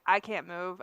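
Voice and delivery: low, soft mock whimper; understated whimpering; retold whimper. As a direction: report it helplessly, softly and low. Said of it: "I'm, like, whimpering".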